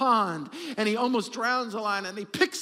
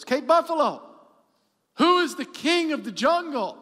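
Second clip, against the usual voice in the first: loud, higher demanding lion voice; proud lion; 6 semitones higher, 6 dB louder than usual. Acted. Lion demanding an answer from the Cape Buffalo, loud and proud. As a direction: boom it out like a swaggering king.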